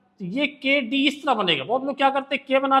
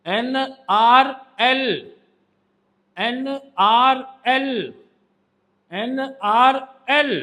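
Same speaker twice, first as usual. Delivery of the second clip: slow drill; much slower than usual. Shown as a demonstration. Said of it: "teacher drilling sounds slowly".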